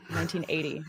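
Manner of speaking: quiet, understated imitation of dad; dad's weary reply. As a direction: answer softly, as if asked endlessly. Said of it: "And he's like".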